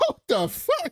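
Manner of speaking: high-pitched startled exclamation; shocked outburst. Retold or imitated. Podcaster retells Dave walking up on the scene, shocked. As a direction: blurt it in mock shock, voice leaping up.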